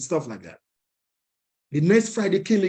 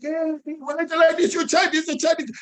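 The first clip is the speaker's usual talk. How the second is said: much higher, strained mock outrage; angry beggars; 11 semitones higher than usual. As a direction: squeal it like an outraged old beggar. Angry beggars shouting at him.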